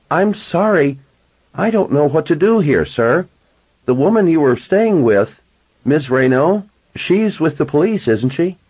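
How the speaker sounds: lightly voiced fussy landlady; hotel clerk voice. A narrator's reading. Narrator voices the desk clerk.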